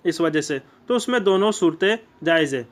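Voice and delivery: ordinary delivery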